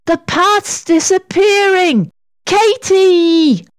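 high, urgent cry; alarmed warning. A narrator's reading. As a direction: call out in alarm to a friend. Sarah warns Katy of danger.

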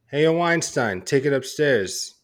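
in character as Dom; gruff movie line delivery; bad acting. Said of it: "Deliver it like as, as if you're Dom Mazzarri".

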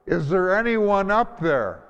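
high, loud call for help from below; desperate yell. Retold or imitated. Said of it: "he started to yell out".

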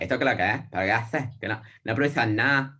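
a light imitation of a strong Andalusian Spanish accent, dropping the S sounds. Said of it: "and then they talk like that".

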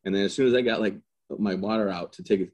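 ordinary delivery

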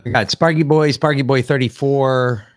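usual speaking voice

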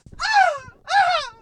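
high burst of gleeful laughter; sudden high cackle of delight; big laugh. Real, not acted. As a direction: crack up mid-rant. Gleeful rant about the Bills.

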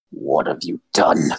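very low, slow voice; menacing half-elf. Acted. Wounded half-elf to their attacker, menacing. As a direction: drop deep and draw the question out.

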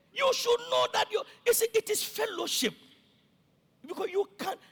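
loud, high-pitched exhortation; impassioned preaching shout. Real, not acted. Pastor telling members what they should know, shouting passionately. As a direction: raise the voice right up to drive it home. Preacher bursts back into scolding.

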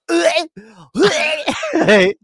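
high loud laughter; laughing hard. Real, not acted. Retelling the prank, laughing loudly. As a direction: crack up helplessly.

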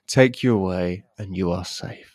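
usual speaking voice

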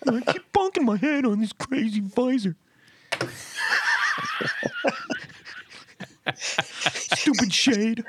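high, slow whiny grumble; silly high voice. Pretend. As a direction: whine like a cartoon character. Comic complaining voice.

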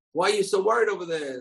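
high, coaxing call; the fox calling out to the fish in the river; sly fox voice. Acted. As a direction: sweet-talk the fish from the bank. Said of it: "the fox tells the fish".

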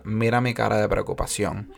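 quiet, flat mock-serious teacher voice; deadpan stern teacher. Teacher mocking a failing student, quiet and deadpan. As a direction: drop the voice, sour and unimpressed. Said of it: "ella te miraba así bien seria y decía".

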